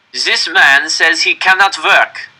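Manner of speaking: loud, slow proclamation; cold officer. A narrator's reading. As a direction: announce a sentence to the yard. Nazi addresses prisoners at gallows.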